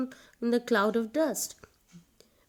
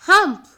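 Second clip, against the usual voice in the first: loud, high, rude "Hump!" grunt; camel's grumpy grunt; 11 semitones higher, 16 dB louder than usual. A narrator's reading. Camel rudely grunts no at the horse. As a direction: bark the camel's refusal as one sharp grunt. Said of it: "Camel replied".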